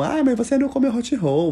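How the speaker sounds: high pushy mimic; high mocking friend pushing food on him; mock insistent friend. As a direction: pitch way up to mock the nag. People insisting he try hot roll.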